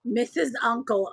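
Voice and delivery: usual speaking voice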